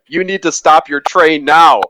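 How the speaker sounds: imitated yell. Someone yelling out to Peter about his train, imitated yell. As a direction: call out a retold order, moderately loud. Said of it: "someone yelled out to Peter".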